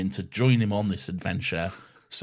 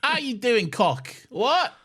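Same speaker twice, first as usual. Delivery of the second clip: high cockney street urchin; squeaky urchin in cockney; old london cockney; 14 semitones higher than usual. Pretend. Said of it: "Victorian street urchins".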